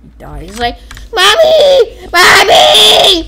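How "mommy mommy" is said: loud, high cry for mother; hammed-up dying scream for help; mock child-like wail. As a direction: wail it like a terrified little kid. Voicing dying victim.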